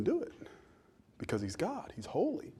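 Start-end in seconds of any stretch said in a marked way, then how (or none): none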